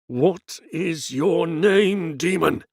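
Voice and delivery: slow, high, loud priestly demand; demanding exorcist. Acted. Priest demanding the demon's name, slow stern command. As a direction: draw out each word like a summons.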